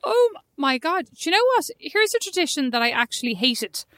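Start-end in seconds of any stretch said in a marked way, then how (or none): 0.0-2.2 sudden recall. Real, not acted. Sudden recollection.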